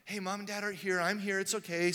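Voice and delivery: soft, gentle comfort; soothing grandma. Retold or imitated. Grandma calming the scared granddaughter down softly. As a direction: hush a crying child. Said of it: "Grammy comes in".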